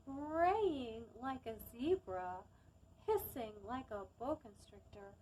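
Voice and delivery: put-on lively storytime voice for young listeners; reading to children. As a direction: read it to a circle of little kids. Reads picture book to children.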